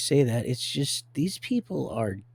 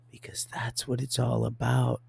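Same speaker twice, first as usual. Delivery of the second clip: normal talking voice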